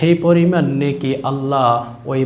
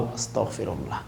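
much lower and softer; hushed aside; 6 semitones lower, 13 dB quieter than usual. Real, not acted. Preacher seeking forgiveness after the hell warning, hushed aside. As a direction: drop to a low, quick plea for forgiveness.

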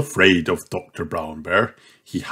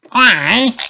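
put-on little boy voice; 13 semitones higher, 3 dB louder than usual. George voiced in story.

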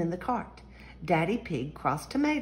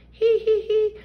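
high, loud squealing hee-hees; the whole family bursting into high giggles; giggling; 8 dB louder than usual. A narrator's reading. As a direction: giggle out loud like delighted piglets. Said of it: "Everyone laughed".

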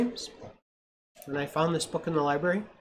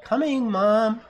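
put-on higher, louder child's call back; 8 semitones higher, 8 dB louder, much slower than usual. A narrator's reading. The girl calling back to her mother, loud and high. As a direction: answer Mom from a distance. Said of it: "She called out".

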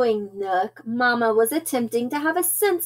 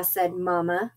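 ordinary delivery, nothing marked

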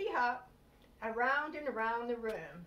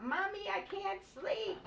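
put-on high, slow child's call from bed; a small bunny calling mommy; higher, slow plaintive little voice; 9 semitones higher, slower than usual. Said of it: "call out".

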